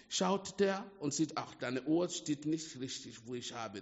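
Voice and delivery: normal talking voice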